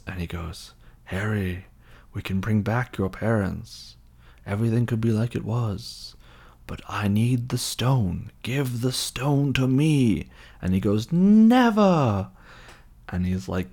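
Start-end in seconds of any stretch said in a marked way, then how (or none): 1.0-10.3 slow villain coaxing, turning whispery; drawn-out sinister persuasion; seductive dark lord. Retold or imitated. Voldemort tempting Harry. 11.1-12.3 very high, loud mock cry; theatrical cry of defiance; defiant shout. Harry's defiant refusal.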